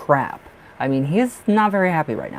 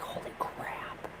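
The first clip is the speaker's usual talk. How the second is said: a slow, breathless whisper; hushed whisper of amazement at the smoke; whispered awe; 18 dB quieter, slower than usual. Retold or imitated. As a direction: whisper it slowly, stunned. Awed by the smoke.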